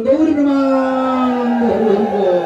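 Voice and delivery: slow drawn-out call to chant; stretched kirtan call; devotional call. Real, not acted.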